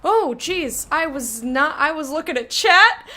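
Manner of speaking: loud, high startled laughing yelp; startled outburst. Real, not acted. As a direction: shriek at the sudden gap. Startled by jump scare gap.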